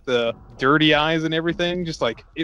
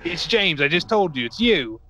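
higher-pitched, hammed-up Cockney, slowing in the middle; bad Cockney accent; 3 semitones higher, slower than usual. Villain greeting captured spy James, in a hammed-up bad Cockney accent. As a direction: badly fake a Cockney villain. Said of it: "They're just all gonna be cockney".

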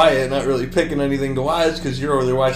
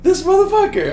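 way higher and louder, half laughing in disbelief; mock exasperated outburst; 6 dB louder than usual. Roommate scolding his sleeping friend, mock outraged high-pitched yelp.

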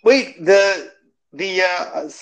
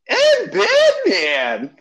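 high, drawn-out squeak of Batman; much slower than usual. Pretend. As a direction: stretch it out way up high. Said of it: "That doesn't sound very convincing".

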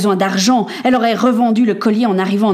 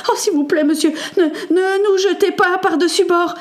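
put-on high, tearful boy's plea; frightened, whimpering supplication; begging; 10 semitones higher than usual. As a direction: stammer and beg in terror. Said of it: "supplia le garçon".

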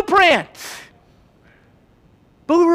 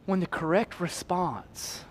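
hushed whisper; 8 semitones lower, 16 dB quieter, slower than usual. Acted. Preacher drops to hush.

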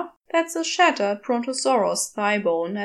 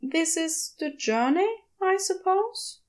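higher, hesitant questioning voice; unsure, trailing up into a question; a lightly voiced gentle angel, unsure of himself; uncertain Aziraphale; 7 semitones higher than usual. A narrator's reading. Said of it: "He doesn't sound certain".

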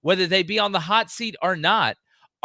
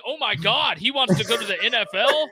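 laughing, hammed-up disbelief; mock outrage; 6 semitones higher than usual. Host mocking overreaction to coaches leaving, laughing mock outrage. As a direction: ham up the fake despair, chuckling. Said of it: "we're acting like".

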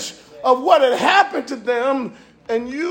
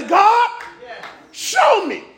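fervent shouted prayer; pleading prayer; 5 semitones higher than usual. Acted. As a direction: beg God for each loved one. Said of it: "And I say".

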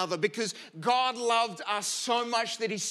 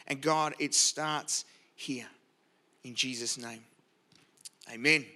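prayer; 2 semitones lower than usual. Acted. Opening prayer before sermon.

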